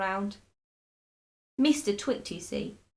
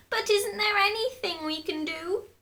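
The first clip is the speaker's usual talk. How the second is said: very high, pleading; desperate cry; 11 semitones higher than usual. A narrator's reading. Desperate wife pleads very high for a cure. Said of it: "cried Missus Twit".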